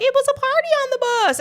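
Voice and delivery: put-on high little-boy voice; young son voice. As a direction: play it like an innocent kid. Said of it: "He was just like".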